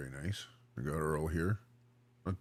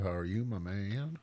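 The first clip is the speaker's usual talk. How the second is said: ordinary talk, nothing marked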